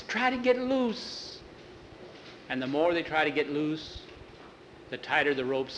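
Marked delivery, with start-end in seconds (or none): 0.0-1.4 scared plea. Acted. Boy tied to tombstone, frightened.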